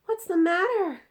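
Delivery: put-on high, loud girl's voice; anxious child asking; little girl. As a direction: Sophie asking the giant. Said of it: "Sophie asked".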